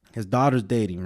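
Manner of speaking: usual speaking voice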